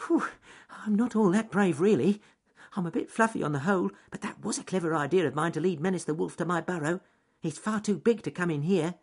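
high, quick little voice after a scare; relieved rabbit. Acted. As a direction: puff out relief and chatter in a high pitch. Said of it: "frightened sigh of relief".

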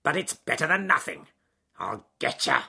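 loud, menacing wolf threat; wolf snarl. Acted. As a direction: snarl as you go after your prey. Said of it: "he snarled".

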